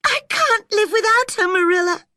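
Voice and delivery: very high, loud tearful wail; sobbing. A narrator's reading. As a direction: cry it out, breaking on the last words. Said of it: "sobbed Anne".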